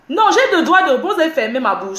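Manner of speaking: high, loud real objection; indignant protest. Real, not acted. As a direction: burst out defending your right to speak. Protesting being silenced.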